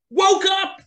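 high, loud burst of storytelling; sudden exclamation. A narrator's reading. Lion startled awake by squeak.

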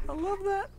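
high squeaky delighted voice. Pretend.

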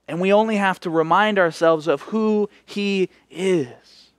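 ordinary talk

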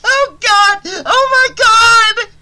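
throat-rending scream of terror; horrified scream. A narrator's reading. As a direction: scream at the severed head. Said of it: "let out a throat-rending scream".

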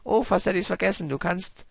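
high, squeaky little Toad complaining. Acted. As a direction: play the distressed mushroom sidekick. Voices Toad's text.

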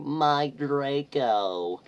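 long, high, loud mock moan. A narrator's reading. Vampire crying over captured Draco, hammed-up moan. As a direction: wail the name slowly, hammed up. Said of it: "he moaned".

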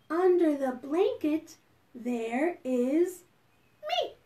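slow, softening recitation; hushed, lilting verse; bedtime rhyme. Pretend. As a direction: lead children gently through a rhyme with pauses.

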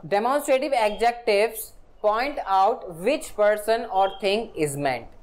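very slow, word-by-word definition read; slow dictation. Shown as a demonstration. Teacher dictating a grammar definition to students, very slow. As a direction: spell it out slowly for students to copy.

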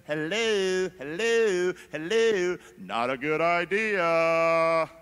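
mock hello anyone home; knocking neighbour. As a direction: knock sense into a friend. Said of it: "comes to you and says".

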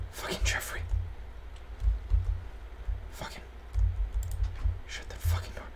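soft whispered swearing; whispered curse. Real, not acted. Gamer hiding from Jeffrey, whispered curses. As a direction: hiss it so the monster won't hear.

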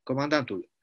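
ordinary delivery

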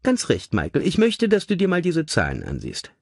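high, chirpy lady's voice; brisk cheerful lady. A narrator's reading. Mother showing her son the figures, brightly high. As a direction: hand it over brightly, lilting high. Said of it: "sagte sie munter".

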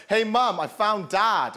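calling across the shop, a little high; little sister calling out. Retold or imitated. Little sister calls to mum she found him, mildly loud call. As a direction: shout over to mum, proud. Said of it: "She's like".

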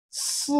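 "si" as a long s with a short falling end. Shown as a demonstration. Teacher recaps the count for learners, falling "si". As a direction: let "si" hiss, then drop. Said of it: "is the falling tone".

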